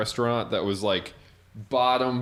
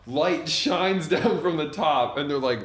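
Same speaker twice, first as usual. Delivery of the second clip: normal talking voice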